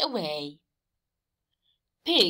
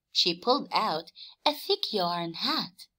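ordinary talk

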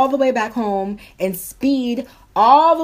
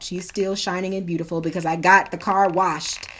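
ordinary talk

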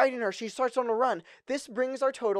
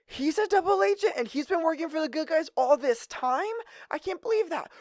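shocked; 6 semitones higher than usual. Real, not acted. Plot twist, Daddy is double agent.

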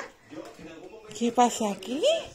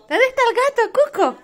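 high pet-talk voice; 11 semitones higher, 8 dB louder than usual. Real, not acted. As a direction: coo it high like talking to a pet. Calling the cat Cuco.

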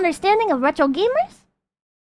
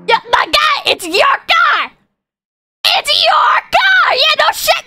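high, drawn-out incredulous yell; shrieking in disbelief; exasperated shouting; 10 semitones higher, 3 dB louder, slower than usual. Real, not acted. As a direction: shout it slowly as if stating the obvious. Yelling at imagined complainer in rant.

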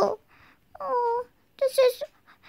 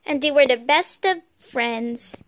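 story narration ending; 11 semitones lower than usual. A narrator's reading.

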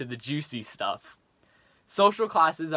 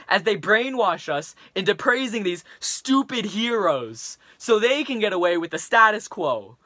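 put-on frustrated teen rant, building louder; Leon, angry rant; 4 dB louder than usual. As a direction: vent at a classmate, getting heated. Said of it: "in the following rant to his classmate".

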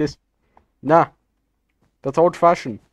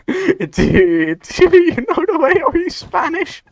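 much higher, through giggles; squeaky laughing voice; 13 semitones higher, much slower than usual. Pretend. Gamer cracking himself up teasing a teammate, squeaky and giggling.

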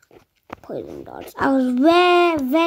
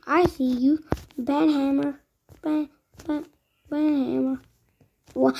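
usual speaking voice

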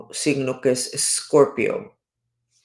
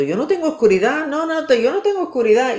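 high, loud, fast protest; mock yell of flat denial; shouting; 10 semitones higher, 4 dB louder, faster than usual. Libra shouting that it has no darkness, loud and high. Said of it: "te veo gritando".

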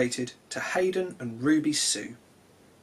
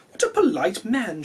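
lady's voice; 6 semitones higher, 3 dB louder than usual. Acted. Doctor's wife thinks aloud.

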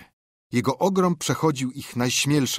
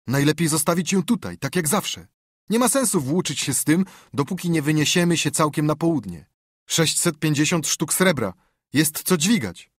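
higher, louder bandit voice; kompan Joego, bandyta; 6 semitones higher, 4 dB louder than usual. Acted. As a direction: voice a practical accomplice, pitched up. (wyższy, głośniejszy głos bandyty)

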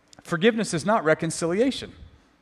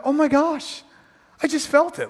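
mock gushing; 7 semitones higher than usual. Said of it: "You don't have to be like".

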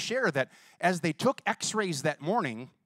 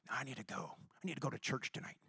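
sick man's weak voice; 3 semitones lower, 13 dB quieter, faster than usual. Retold or imitated. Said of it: "He's like".

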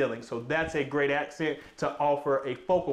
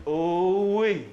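delighted ooh-wee; 3 dB louder than usual. Real, not acted. Delighted on entering the home.

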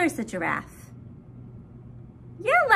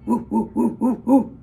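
low mock gorilla hooting; lightly voiced gorilla woo woo; gorilla hoots; 6 semitones lower, 3 dB louder than usual. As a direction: hoot like a playful gorilla. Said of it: "And the gorilla says".

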